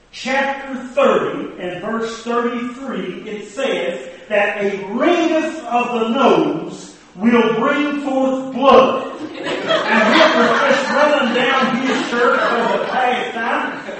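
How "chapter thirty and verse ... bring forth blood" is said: preacher pronouncing the Bible verse as a verdict; solemn scripture quote. Retold or imitated. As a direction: intone the verse like a sermon.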